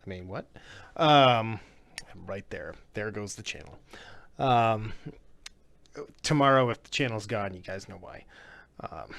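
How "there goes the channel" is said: whispered mock doom; whispering. Real, not acted. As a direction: whisper it as a doomed aside. Joking doom aside.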